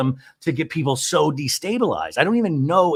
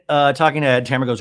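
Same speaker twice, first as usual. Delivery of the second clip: normal talking voice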